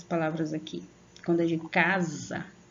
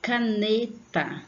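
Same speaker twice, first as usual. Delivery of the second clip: Caneta slow, NE stretched and higher; sílaba forte; 4 semitones higher, much slower than usual. Shown as a demonstration. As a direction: hold the NE in Caneta. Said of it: "saber se a sílaba é forte". (Caneta com o NE alongado)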